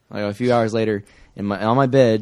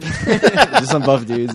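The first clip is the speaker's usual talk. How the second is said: slow drawl; 2 semitones higher, 5 dB louder, much slower than usual. Acted. Drawn out mocking.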